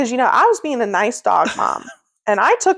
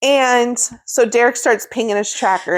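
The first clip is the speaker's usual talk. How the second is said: normal talking voice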